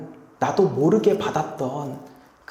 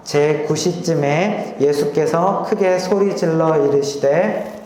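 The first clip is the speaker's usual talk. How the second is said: group scripture reading; slower than usual. Real, not acted. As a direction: read the verse out together slowly. Congregation reading aloud together.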